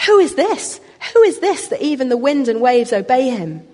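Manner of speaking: high, loud, alarmed cry of who is this; terrified disciples. Retold or imitated. Disciples asking one another in terror, high alarmed voice. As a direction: voice the disciples' panicked question. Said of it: "they were terrified and that they asked one another".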